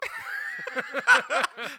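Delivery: big real belly laugh; loud laugh. Real, not acted. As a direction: burst out laughing loud and high. Big laugh after joke.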